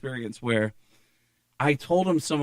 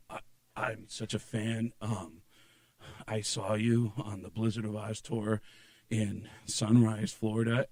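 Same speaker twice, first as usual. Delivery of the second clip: soft, low, halting admiration; shy fan; 5 semitones lower, 9 dB quieter than usual. Retold or imitated. Comedian as his friend meeting an idol, shy. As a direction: murmur it slowly, a little starstruck.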